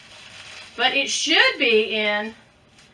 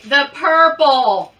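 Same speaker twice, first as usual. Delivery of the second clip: usual speaking voice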